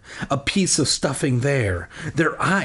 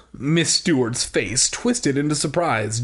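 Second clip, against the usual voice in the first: ordinary talk, nothing marked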